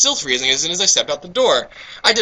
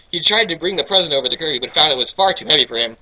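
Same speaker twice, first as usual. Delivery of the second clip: ordinary talk, nothing marked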